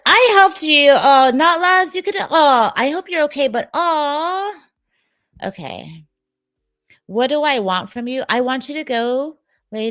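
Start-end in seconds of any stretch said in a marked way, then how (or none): 0.0-4.5 much higher, loud whine-along as a brother; mock helpful brother. Streamer imitating a brother who helped his sister, hammed-up and high. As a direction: play up the brother's plea, pitched way up. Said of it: "Are you one of those brothers like".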